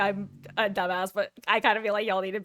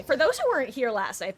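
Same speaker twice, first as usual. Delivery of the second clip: ordinary talk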